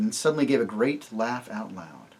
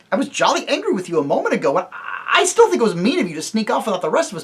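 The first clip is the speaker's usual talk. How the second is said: excited rush; 9 semitones higher, 6 dB louder, faster than usual. Acted. Eustace excited.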